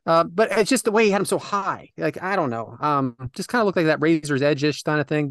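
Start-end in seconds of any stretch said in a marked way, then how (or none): none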